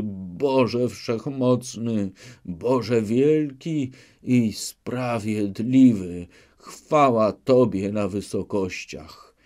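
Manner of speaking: slow, loud, solemn thanksgiving; deputy on his knees thanks God, praying slowly; fervent prayer. A narrator's reading. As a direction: lift the prayer slowly toward heaven. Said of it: "przerwał mu modlitwę".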